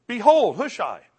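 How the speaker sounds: high, loud burst on "Behold"; amazed exclamation. Retold or imitated. Hushai appears as David prays, amazed exclamation. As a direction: herald a sudden arrival with excitement. Excited re-telling of sudden arrival.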